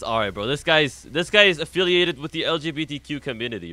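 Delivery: ordinary talk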